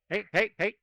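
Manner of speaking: normal talking voice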